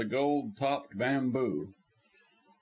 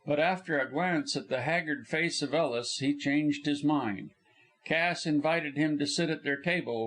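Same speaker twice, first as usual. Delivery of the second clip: ordinary delivery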